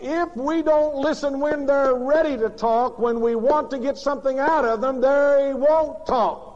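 loud, high, slowed-down preaching; preaching at full force. Acted. Preacher warning parents from the pulpit, loud and slow. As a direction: drive the warning home from the pulpit. Preacher driving home a point.

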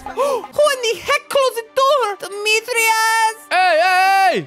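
shouting mom voice. Acted. Mom shouting, finding intruder.

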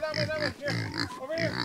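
low, rumbling playful voice; deep voice for the chickens. Pretend. Farmer teasing his chickens in a very low, playful voice. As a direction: drop way down and tease the hens.

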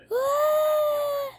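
a sudden high scream, played up for the camera; showing off her scream; mock scream. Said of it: "That's how I scream".

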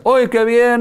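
high mock delight; mocking the fake cheerful reaction. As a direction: chirp it in fake happiness. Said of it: "no estoy diciendo que le digas".

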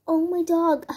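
light up at the cute bird; surprised. Pretend. Gasps at the little bird.

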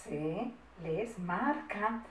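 measured word-by-word dictation; slow dictation. Shown as a demonstration. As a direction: repeat softly at writing speed.

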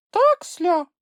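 very high, loud tiny creature; high little creature's cry; squeaky exclamation. A narrator's reading. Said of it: "вигукнула Чупсля".

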